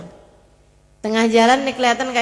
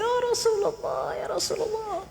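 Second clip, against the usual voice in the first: high, soft, fading mock sobs; imitated weeping; 9 semitones higher, 9 dB quieter than usual. Old man weeps for the Prophet, imitated tearful cry. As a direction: act out a tearful plea, dying away. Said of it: "Nangis-nangis dia".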